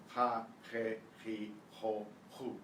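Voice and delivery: "Ha, je, chi, ho, hu" each opening on a throaty h; Spanish J drill. Shown as a demonstration. Teacher running the J through every vowel, "Ha, je, chi, ho, hu" with throaty h. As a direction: separate syllables "Ha, je, chi, ho, hu", same raspy J. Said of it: "J is always pronounced the same".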